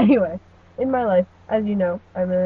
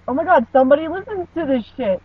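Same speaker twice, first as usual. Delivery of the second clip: excited disbelief; 6 semitones higher, 6 dB louder than usual. Retold or imitated. Retold shocked reaction to email.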